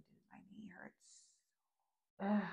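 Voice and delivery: whispered, hurting complaint; pained whisper. Retold or imitated. Admitting to himself his knee hurts, whispering. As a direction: breathe it out in pain. Retold knee pain on hike.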